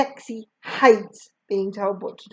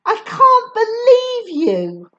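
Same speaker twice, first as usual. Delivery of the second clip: slow, loud, high reproach; indignant, pitched right up; annoyed; 13 semitones higher, 8 dB louder, slower than usual. A narrator's reading. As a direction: stretch it out in exasperation. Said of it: "Sadie sounds annoyed".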